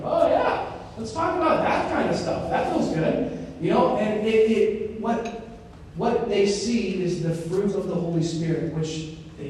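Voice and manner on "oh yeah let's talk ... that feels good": eager mock reply. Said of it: "You're like".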